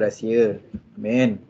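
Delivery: ordinary talk, nothing marked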